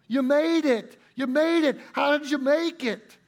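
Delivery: excited shout of disbelief; amazed excited exclaim. Acted. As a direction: call out in wonder at him making it. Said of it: "simplified country version of Alistair Begg's preaching".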